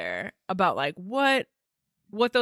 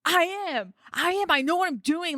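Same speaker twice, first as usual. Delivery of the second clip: defensive; 9 semitones higher than usual. Retold or imitated. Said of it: "I'm like".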